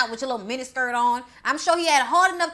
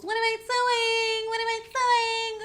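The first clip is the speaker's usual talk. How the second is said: high, slow sing-song dopey voice; high slow mock-innocent sing-song; mock Sydney; 11 semitones higher, slower than usual. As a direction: drawl it out like a clueless girl. Mocking Sydney picking her nose.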